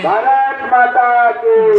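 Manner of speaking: slow, high drawn-out slogan call; booming political rally speech. Real, not acted. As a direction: stretch the call out to the crowd. Leads slogan chant at rally.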